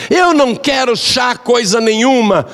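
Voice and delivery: put-on high shouted refusal from a grumpy old woman; angry refusal. Rude mother-in-law refuses tea. (recusa gritada, aguda e irritada)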